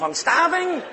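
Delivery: high-pitched mock-desperate cry; starving outburst. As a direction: shoot the voice up, ravenous. Acts out his desperate hunger.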